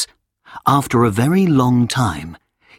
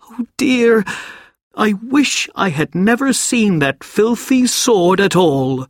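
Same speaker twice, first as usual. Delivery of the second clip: much higher, breaking "oh dear"; boy about to burst into tears over the sword; tearful boy; 10 semitones higher than usual. A narrator's reading. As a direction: let the boy's voice crack toward tears.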